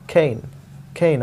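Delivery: खेन said with a sound between k and kh; खेन, half-aspirated k, short e; khen demo. Shown as a demonstration. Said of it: "क और ख के बीच वाला".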